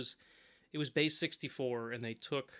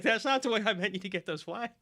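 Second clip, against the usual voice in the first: laughing while quoting, higher; laughing quote; 8 semitones higher, 6 dB louder than usual. Retold or imitated. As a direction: chuckle through it. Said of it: "he's like".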